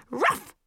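short barked ruff; dog-like bark. A narrator's reading. Boy barking at his classmate mid-argument, a short ruff. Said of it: "stop barking".

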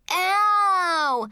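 high, wailed cry of pain; fake crying. A narrator's reading. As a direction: wail it like a kid pretending to be hurt. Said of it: "he started fake crying".

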